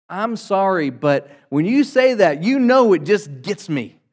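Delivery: mock someone who blames the other; imitating a half-hearted sorry; fake apology. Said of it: "That's not an apology. That's an excuse".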